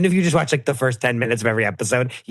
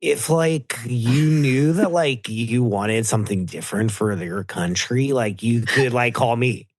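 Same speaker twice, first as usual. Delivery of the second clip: drawling California frat-accent impression; Patrick Suzuki; slower than usual. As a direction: play a bro in a halting California accent. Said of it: "Do Patrick Suzuki running for president".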